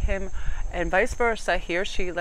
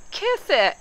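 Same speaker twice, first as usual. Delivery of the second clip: high, loud comic squawk; mock-defiant shriek before cracking up; playful taunt; 11 semitones higher, 6 dB louder than usual. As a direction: holler it as a cheeky dare. Jokey taunt, then bursts out laughing.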